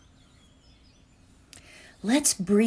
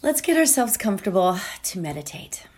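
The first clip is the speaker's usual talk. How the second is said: whisper; faster than usual. Real, not acted. Host inviting listeners to settle in, whispered. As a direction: breathe the words as a whisper to settle them.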